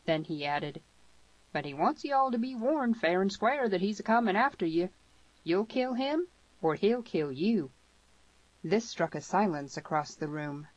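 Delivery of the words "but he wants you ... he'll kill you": higher, emphatic rustic westerner; warning Harmony. A narrator's reading. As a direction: deliver a blunt warning in character.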